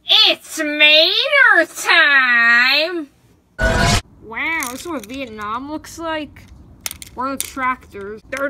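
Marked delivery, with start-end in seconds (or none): 0.0-3.1 loud, very slow drawn-out battle cry; hammed-up slow war cry. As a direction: stretch it out like a war whoop. Battle cry before fighting.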